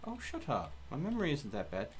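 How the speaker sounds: ordinary talk, nothing marked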